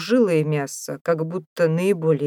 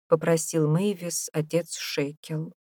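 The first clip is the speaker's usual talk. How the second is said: plain reading voice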